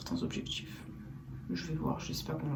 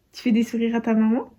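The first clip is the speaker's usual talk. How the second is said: higher, louder sing-song question; 4 semitones higher, 12 dB louder than usual. Real, not acted. Asking baby a question.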